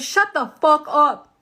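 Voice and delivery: imitating a loud, slow angry command; acting out a partner's furious dismissal; angry retort. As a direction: cut in loudly, each word drawn out. Acting out husband's angry interruption.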